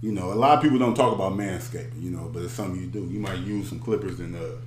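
ad read. Acted.

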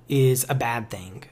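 ordinary talk, nothing marked

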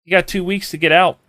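lightly acted parent laying down a deadline; parent's hard ultimatum. Parent telling their kids to move out, mild ultimatum. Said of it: "very hard as a parent to sit there and tell your kids".

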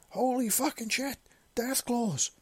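high-pitched yell of alarm; terrified yell. A narrator's reading. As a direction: cry out in sudden terror. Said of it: "the man yelled".

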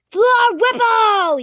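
loud, high, drawn-out incantation; yelled spell. A narrator's reading. Boy casts the disarming spell, drawn-out high yell. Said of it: "yelled Soren".

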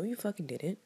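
ordinary talk

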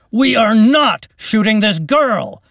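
put-on captain standing between his men and the girl, insisting. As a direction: lean on every word, refusing to budge. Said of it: "the captain insisted".

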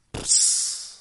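breathy squelch of a blade going in; imitated stab sound. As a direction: mimic the knife piercing flesh. Stabbing described. (模仿刀刺入的噗呲声)

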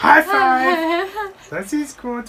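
high-pitched, put-on creature voice; creature's high-five voice. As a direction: squeal it in a high character voice. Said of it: "That's how they would say it".

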